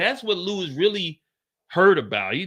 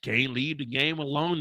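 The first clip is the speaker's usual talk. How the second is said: usual speaking voice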